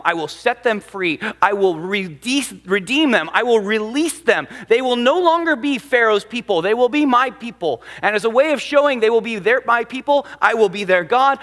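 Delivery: rising to a loud, high proclamation; preacher proclaiming God's promise with growing force; building preaching. Acted.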